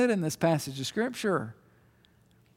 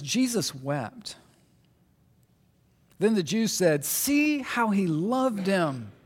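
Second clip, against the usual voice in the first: 1 scripture reading; 2 semitones lower than usual. Acted.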